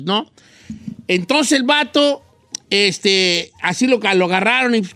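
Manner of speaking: usual speaking voice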